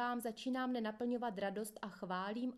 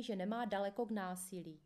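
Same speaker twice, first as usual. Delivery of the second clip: ordinary delivery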